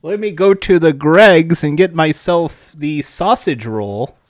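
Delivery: put-on British voice, dropping at the end; mock British accent. A Brit heading to Greggs, mock British accent. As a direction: play an exaggerated Brit. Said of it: "this is a British accent".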